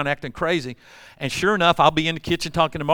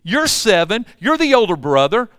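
firm scolding; 6 semitones higher, 5 dB louder than usual. Retold or imitated. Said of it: "He's saying".

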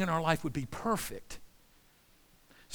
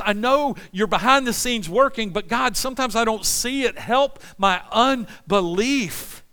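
louder, higher prayer preached at full force; fervent preaching prayer; 5 semitones higher, 6 dB louder than usual. Acted. Preacher praying aloud to God, fervent and loud. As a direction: cry out to God from the pulpit.

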